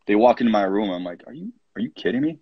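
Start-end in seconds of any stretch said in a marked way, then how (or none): 1.3-2.4 high, fast disbelief; incredulous. Retold or imitated. Coach confronting players back from the bar, incredulous. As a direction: rattle it off, pitched up in disbelief.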